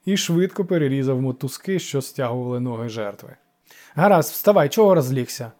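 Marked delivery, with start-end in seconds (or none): 4.0-5.5 louder, brusque order; brusque young god's grumble; gruff grumble. A narrator's reading. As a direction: grumble it out with some force. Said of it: "буркнув юний бог".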